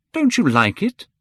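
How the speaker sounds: high, loud worried question; cried out. A narrator's reading. Painter cries out to the silent boy, high and loud. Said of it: "cried Hallward".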